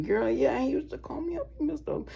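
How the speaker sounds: very high, mocking girl's voice; a hammed-up high gossip complaining. Mocking girls gossiping about him, very high. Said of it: "people will be like".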